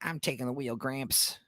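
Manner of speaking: softly voiced mock grandson; hushed, put-on grandson line. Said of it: "have the grandson show up and be like".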